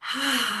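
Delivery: short audible sigh. Shown as a demonstration. Guide starts the group on breathing, audible sigh. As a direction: let out an easy, voiced sigh. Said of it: "and then a sigh out".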